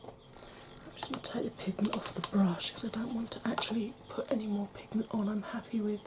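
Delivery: soft, breathy whisper while blending; ASMR whisper. Real, not acted. Makeup artist whispering to viewers while blending eyeshadow. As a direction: hush it down like an ASMR video. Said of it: "I'm heading a bit into A S M R territory".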